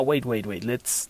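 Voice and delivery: usual speaking voice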